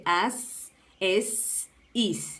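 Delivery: AS, ES, IS slowly, each ending in a long hissed s; drawn-out final S. Shown as a demonstration. Teacher contrasts S with Z, lingering on each final s. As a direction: hold the s at the end of as, es, is. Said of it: "Las consonantes también tienen sonidos distintos".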